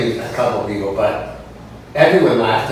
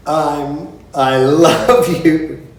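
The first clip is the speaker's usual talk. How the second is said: slow, louder mock "I love you"; louder slow mock diva; 4 semitones higher, 5 dB louder, much slower than usual. Singer on stage doing the gesture, slow mock diva. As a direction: stretch "love" like a grand diva. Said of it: "And she laughs goes".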